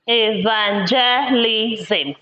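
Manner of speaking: "Evangelism" said slowly, syllable by syllable; slow teacher's "Evangelism"; slow syllable split. Shown as a demonstration. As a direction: draw out "Evangelism" so the syllables can be counted. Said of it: "This has five syllables".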